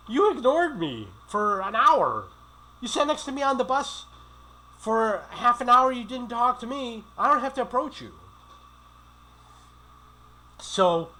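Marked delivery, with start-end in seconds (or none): 0.1-8.1 much higher, louder indignant rant; indignant retort. Retold or imitated. Man retorting to women who ignored him, indignant and high. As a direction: throw their snub back at them.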